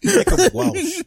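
shouting through laughter; laughing loud outburst. Real, not acted.

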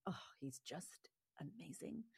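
whispered, breathless with admiration; awed whisper. Real, not acted. Guest gushing about her teacher Gabor Mate, in a whisper. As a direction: hush it like a secret you're in awe of.

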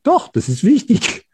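a sudden burst of stubborn conviction; insistent inner voice. Retold or imitated. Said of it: "Hab ich immer gedacht".

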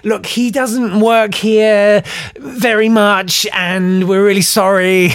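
re-enacted company smoothing things over; awkward placating. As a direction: drag out an embarrassed apology. Company placating offended client.

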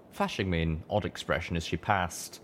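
usual speaking voice

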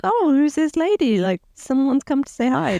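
lightly voiced innocent girl; innocent Sophie. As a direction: play it naive and sweet. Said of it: "Sophie's all completely innocent and like".

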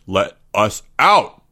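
slow, word-by-word demand. A narrator's reading. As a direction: demand it, leaning on each word. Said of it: "demanded Greg".

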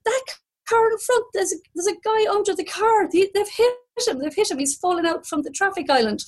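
high, stammering alarm; retold panic. Driver tells woken passenger of man under car, retold panic.

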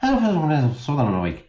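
put-on high, lilting southern Irish accent. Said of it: "can you do your Irish accent".

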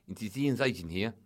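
put-on South African agent on the phone; slow, drawn-out South African accent at the end. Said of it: "just speak normally".

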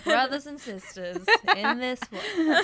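slow, laughing sermon voice; mock preacher. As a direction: ham up a televangelist. Mocking preachy [name], laughing.

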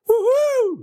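a proud dad's whoop; whooping cheer. Acted. Dad cheering for his son's class, high whoop. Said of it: "råber Maltes far alt for højt".

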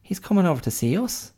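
high, worried pig voice; a pig spotting trouble coming; high, uneasy pig chatter; alarmed pig. Retold or imitated. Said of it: "the pigs are like".

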